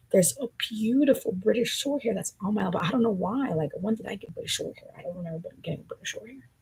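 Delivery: ordinary delivery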